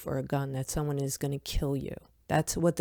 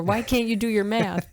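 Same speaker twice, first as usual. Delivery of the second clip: mild, higher-pitched imitation scolding; mock yell; 4 semitones higher than usual. Young teacher to three-year-old cousins, mild mock yelling. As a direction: play at yelling at a pupil. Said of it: "I would yell at them".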